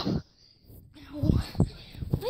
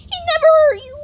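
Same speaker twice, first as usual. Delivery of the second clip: much higher and louder, whiny plea; high whiny pet voice; 10 semitones higher, 6 dB louder, much faster than usual. Acted. Defending the cat from the mean player, loud high whine. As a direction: whine it for the poor kitty.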